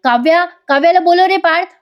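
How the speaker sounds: high-pitched mild shout; hammed-up calling out; mock yell. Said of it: "ओरडत जोरात".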